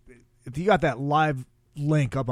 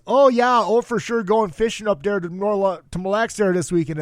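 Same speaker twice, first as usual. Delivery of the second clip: high, hammed-up Minnesotan accent; 7 semitones higher, 6 dB louder than usual. Local heading out fishing, exaggerated Minnesotan accent. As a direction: parody an Upper Midwest Minnesotan accent. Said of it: "has ever heard the phrase".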